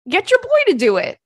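high, loud insistence; excited urging. Retold or imitated. Urging his friend about the light fixture, high excited insistence. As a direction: push her friend to ask him.

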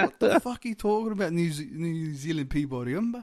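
high-pitched imitation of an exasperated customer; mock incredulity. Customer hearing about a New Zealand blend, in mock outrage. Acting out Paulie at coffee blend.

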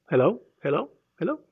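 sing-song hellos, rising; hammed-up nagging hellos; nagging Holy Spirit. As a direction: pester him with mock calls.

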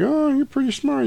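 high squeaky teasing falsetto; mock teasing Mia. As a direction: tease him in a high put-on voice.

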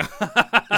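high, loud forced laugh; fake laugh. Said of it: "I have to give a fake laugh".

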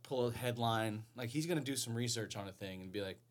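ordinary talk

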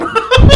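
high, loud laughing burst; loud burst of laughter. Real, not acted. Laughing at wasted movies.

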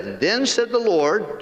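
ordinary talk, nothing marked

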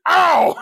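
mimicked "ow", high and very loud; high, loud imitated yelp; loud imitated cry. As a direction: blurt out a big comic yell. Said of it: "I can hear is".

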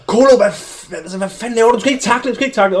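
mock manager yelling, pitched high; high-pitched, hammed-up scolding; retold shout. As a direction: imitate an exasperated manager telling off a player. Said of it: "manden, der står og råber".

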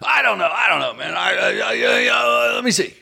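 Rich flustered. Retold or imitated. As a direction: stall nervously, voice up high. Voicing Rich again.

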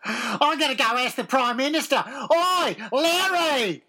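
hammed-up Aussie holler; Australian accent. Shown as a demonstration. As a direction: call out to a mate in broad Australian. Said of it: "Do an Australian accent".

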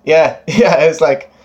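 breaking into a laugh on yeah; giggly, bright yeah yeah. Real, not acted. Laughing while answering.

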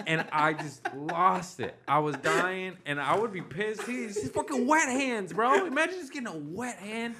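ordinary talk, nothing marked

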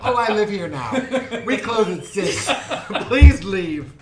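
loud mock shopkeeper, laughing. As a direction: shoo them out, grinning. Mock shopkeeper shooing customers.

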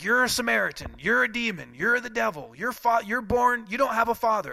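high, loud hammed-up hurling of insults; sling insults like a debate brawl; mock insults. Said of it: "just throwing insults around".

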